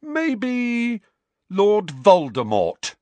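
high drawn-out musing, then loud emphatic name; mock thoughtful. A narrator's reading. Student Harry naming who would attack them, mock thoughtful. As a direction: muse sarcastically, then land the name hard. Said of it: "in a mock thoughtful voice".

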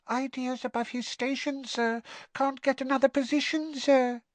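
high-pitched, disapproving elf squeak; sad house elf. A narrator's reading. Winky tells Harry of Dobby's troubles, sad squeak. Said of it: "said Winky sadly".

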